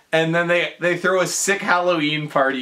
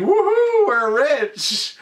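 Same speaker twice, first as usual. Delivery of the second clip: very high, loud "Woohoo"; joyful whoop; 11 semitones higher, 4 dB louder, slower than usual. Retold or imitated. Everyone dancing in slow-mo, whooping with joy.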